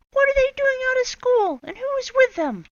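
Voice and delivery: high, loud rasping demand; scratchy voice. Acted. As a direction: rasp out an angry question. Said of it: "she said in a scratchy voice".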